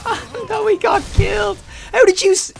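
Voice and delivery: high, panicked shouts building to a laughing scream; frantic yelling. Real, not acted. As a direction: scream the teammate's name as you get killed. Yelling at teammate while dying.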